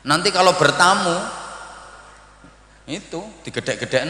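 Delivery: ordinary delivery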